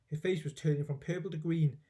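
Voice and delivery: usual speaking voice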